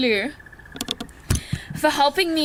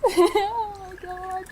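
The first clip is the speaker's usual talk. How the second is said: tearful laugh; 6 semitones higher, 4 dB quieter, slower than usual. Real, not acted. Overwhelmed during valedictory speech.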